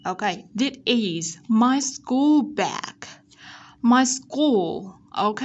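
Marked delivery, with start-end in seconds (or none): 0.6-3.0 slow, careful "this is my school bag"; word-by-word teacher model of "this is my school bag"; model reading. Shown as a demonstration. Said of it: "S ở đây chúng ta sẽ đọc là âm Z". 3.8-4.9 "My school" with a drawn-out final l; careful demo of the l in "school"; curled-tongue L. Shown as a demonstration. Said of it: "sẽ uốn cong lưỡi này để phát âm".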